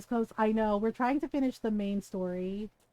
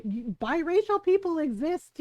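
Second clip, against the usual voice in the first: higher, louder exasperated outburst; indignant retort; 7 semitones higher, 5 dB louder than usual. Retold or imitated. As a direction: burst out at their ignorance.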